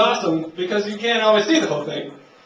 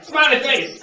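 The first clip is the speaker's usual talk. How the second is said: goofy soldier answer; 7 semitones higher, 4 dB louder, slower than usual. Acted. Soldier's goofy reply in bit.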